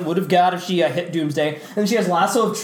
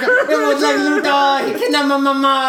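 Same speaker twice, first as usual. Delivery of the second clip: higher, slurred dopey hero; mock accusation; 5 semitones higher, 3 dB louder than usual. Friend mocking Superman blaming Luthor, dopey whine.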